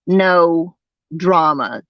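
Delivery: ordinary delivery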